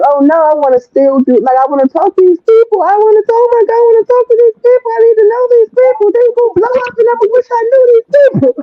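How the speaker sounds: desperate not to lose the chance to meet artists; rising frantic eagerness. Retold or imitated. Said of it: "I was like".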